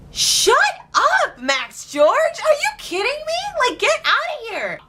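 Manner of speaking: high, shrieking disbelief; outraged squeal. Real, not acted. Fan squealing at [name], high, outraged disbelief. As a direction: squeal it at him like a betrayed fan.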